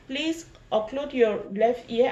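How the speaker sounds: usual speaking voice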